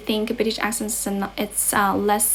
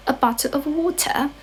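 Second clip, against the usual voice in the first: higher-pitched British accent, words completed; 5 semitones higher than usual. Shown as a demonstration. Completing the demo sentence for viewers, fuller British accent. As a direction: say it British, finishing "bottle" and "water". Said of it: "how to say in British accent".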